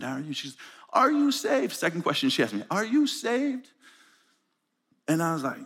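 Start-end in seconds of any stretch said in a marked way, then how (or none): 0.9-1.7 put-on high, emphatic question in a thick African accent. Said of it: "in the thick accent". 2.7-3.7 put-on high, emphatic question in a thick African accent. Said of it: "in the thick accent".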